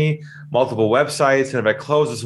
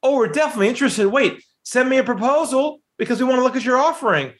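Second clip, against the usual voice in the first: high, breezy fake-eager prospect; mocking the prospect's fake interest, pitched up; mock polite brush-off; 10 semitones higher than usual. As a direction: parrot the empty yes in a chirpy voice. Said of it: "It's okay to say things like".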